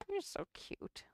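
high whispered coo to the cat. Real, not acted. Owner whispering fondly to her cat Chuck. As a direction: breathe it softly at the kitten.

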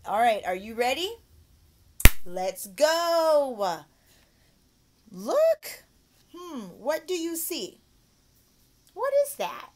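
put-on high, loud, drawn-out rallying of a child; excited teacher. Shown as a demonstration. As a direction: whip up a little pupil's curiosity. Demo rallying pupil to look.

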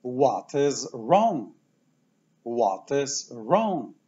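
"What is wrong?" said slowly and deliberately; slow model question. Shown as a demonstration. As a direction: spell it out slowly for learners. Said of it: "co dolega?". (powoli i wyraźnie, jak dla uczniów)

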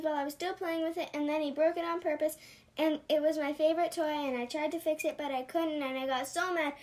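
rushed, tumbling list of woes; breathless rant. Acted. Upset boy pours out his troubles, fast. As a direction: rattle it off without stopping. Sam's long breathless complaint.

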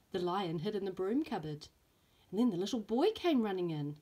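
lively storybook reading with voiced characters; reading to children. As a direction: read it like story time for little ones. Teacher reads picture book aloud.

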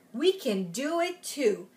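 ordinary delivery

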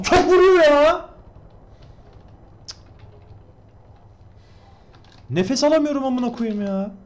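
high-pitched breathless panic; panicked shriek. Real, not acted. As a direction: squeal in overwhelmed panic. Being shot at, panicking.

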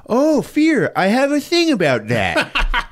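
high, drawn-out hammed-up exclamation; mock delight. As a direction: seize on it with showy, laughing glee. Acting out his excited reply in retold scene.

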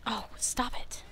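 breathy whisper, begging the boss to stop; whispered stop it at the game; whispered plea. Real, not acted. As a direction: hush it out like a desperate prayer.

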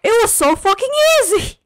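exasperated outburst. Real, not acted. Frustrated after dying again.

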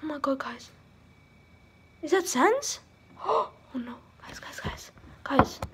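hushed frightened whisper; scared whisper. Pretend. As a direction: whisper like something's near. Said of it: "I'm scared".

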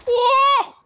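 very high, loud "oh"; sudden piercing yelp; startled scream. Real, not acted. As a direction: shriek as if jumped. Startled in game.

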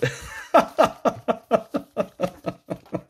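high giggle; laugh, helpless. Real, not acted. Laughing at bad luck.

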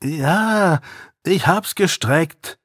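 Joey reluctantly admitting to Kessel; reluctant admission. Acted. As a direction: confess it unwillingly. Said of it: "widerwillig ein".

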